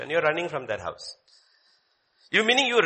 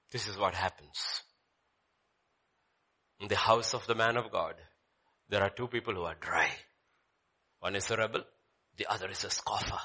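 much softer, low; soft preaching; 7 semitones lower, 8 dB quieter than usual. Real, not acted. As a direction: lower it to a near hush. Quiet preaching aside.

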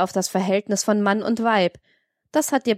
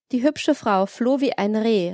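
usual speaking voice

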